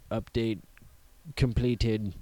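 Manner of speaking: flat mechanical robot voice. Pretend. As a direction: announce it like a machine. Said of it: "Robot voices".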